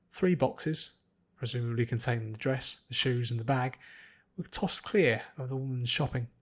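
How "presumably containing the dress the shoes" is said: ordinary delivery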